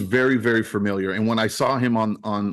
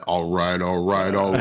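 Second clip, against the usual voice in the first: Matthew McConaughey impression; 5 semitones lower than usual. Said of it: "Clayton's a perfect fit for that".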